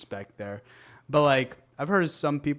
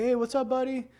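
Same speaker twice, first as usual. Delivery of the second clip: high, light and friendly Hey, what's up, buddy?; a cheerful friendly greeting; 12 semitones higher than usual. Shown as a demonstration. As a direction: greet him brightly, high up. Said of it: "It's all about the tone of voice".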